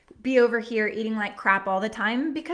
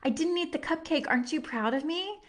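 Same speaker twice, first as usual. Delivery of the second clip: higher, softer little daughter asking proudly; little girl voice; 5 semitones higher, 5 dB quieter than usual. Retold or imitated. Mother voicing her daughter, soft little girl. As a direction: play the small girl seeking praise, softly. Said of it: "your daughter came up to you and said".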